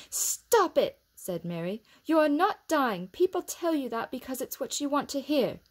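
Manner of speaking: put-on high, loud girl's scolding; firm scolding. A narrator's reading. Mary scolding Colin for punching his pillow, loud. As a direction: snap him out of his tantrum.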